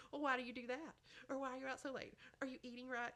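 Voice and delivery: lightly voiced questioning parent. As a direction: fire off worried-parent questions, lightly acted. Acted parents' pushback questions.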